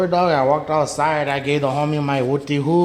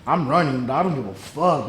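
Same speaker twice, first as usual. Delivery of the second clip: ordinary talk, nothing marked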